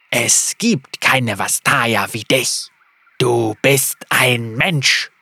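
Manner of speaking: slow lisping creature voice; lisping reptile voice. A narrator's reading. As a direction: drag the words with a hiss. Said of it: "seltsamen Lispeln".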